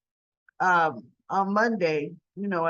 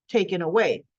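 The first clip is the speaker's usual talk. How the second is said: ordinary talk, nothing marked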